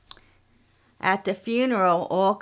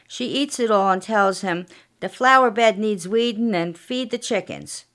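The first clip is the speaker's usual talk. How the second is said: plain reading voice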